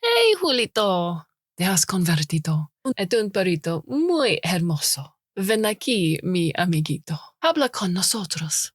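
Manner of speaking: put-on careful school-Spanish accent, coaxing; high school Spanish. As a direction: coax in stiff school Spanish. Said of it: "in my best high school Spanish".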